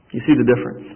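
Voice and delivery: ordinary talk, nothing marked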